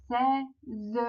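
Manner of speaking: "Seize" in two separate beats, sei... ze; split into syllables. Shown as a demonstration. Teacher splits word into syllables.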